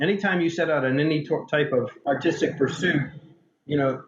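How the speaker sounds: ordinary talk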